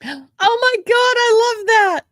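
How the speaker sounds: high, loud gasp of delight at a joke; delighted shout. Real, not acted. Reacting to her friend's spider joke, delighted shout.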